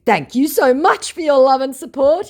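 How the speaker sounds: squeaky overfriendly con man; hammed-up scammer voice. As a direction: play the oily scammer saying hello. Scammer's chat message voiced.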